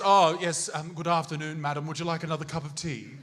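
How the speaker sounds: polite old-time English accent, hammed up; mock English settler accent. Settler offering madam tea, mock English accent. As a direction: imitate a courteous English tea server. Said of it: "we were all speaking like this".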